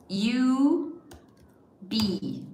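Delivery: ordinary talk, nothing marked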